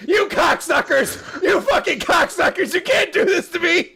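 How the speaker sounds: high, loud, mock-furious yelling. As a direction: scream it in fake outrage, half laughing. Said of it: "And I was like".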